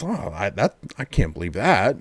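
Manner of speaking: soft, stunned disbelief; retelling a guy stunned by a cop; incredulous. Retold or imitated. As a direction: mutter it in quiet disbelief. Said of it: "it was an incredulous".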